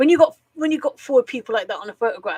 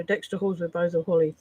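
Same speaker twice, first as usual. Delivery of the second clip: fast, quiet, throwaway delivery; rushed mumbled punchline; 4 semitones lower, 4 dB quieter, faster than usual. Shown as a demonstration. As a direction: rattle it off without landing it. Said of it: "Not like".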